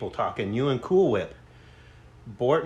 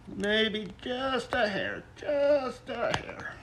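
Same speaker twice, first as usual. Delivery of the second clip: strained high effort; 9 semitones higher, 7 dB quieter, much slower than usual. Real, not acted. Straining while adjusting weight.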